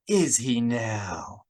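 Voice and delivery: slow, drawn-out sceptical question; coolly sceptical retort. A narrator's reading. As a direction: let the doubt hang on each word.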